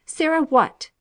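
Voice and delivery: high, loud and sharp question; sharp demand. A narrator's reading. Fanny snapping at the maid, impatient and sharp. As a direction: snap the question at her.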